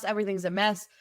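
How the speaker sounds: normal talking voice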